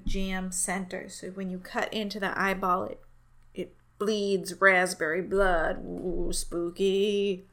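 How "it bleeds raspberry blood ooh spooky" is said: spooky voice. Pretend. Playful spooky dessert description.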